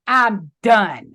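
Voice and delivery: loud, decisive "I'm done"; emphatic, resolved finality; firm self-declaration. Retold or imitated. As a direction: declare it to yourself, hard and final. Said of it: "I was telling myself".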